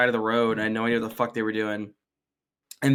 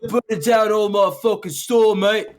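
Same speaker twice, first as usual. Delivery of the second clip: put-on high, loud rough London chav accent; 8 semitones higher, 4 dB louder than usual. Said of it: "a chav accent".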